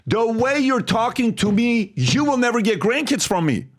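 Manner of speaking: high-pitched challenge to a father; retold argument. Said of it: "One of the biggest fights I ever had".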